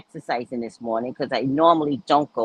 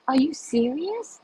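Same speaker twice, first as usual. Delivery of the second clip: high, laughing are you serious; laughing surprised question; delighted surprise; 9 semitones higher than usual. Real, not acted. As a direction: gasp it out with delight. Delighted at viewer's new job.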